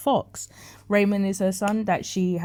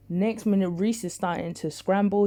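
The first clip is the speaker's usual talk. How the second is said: ordinary talk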